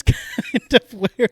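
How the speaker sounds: laughing. Real, not acted. Laughing while explaining ending.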